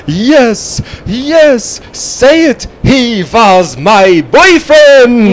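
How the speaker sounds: very high, hammed-up shrieking confession; Frau Blucher impression. Confronted on the stairs by the others, a hammed-up high shrieking confession. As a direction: build to a slow, drawn-out high cry. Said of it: "when she's playing the violin and they're being confronted".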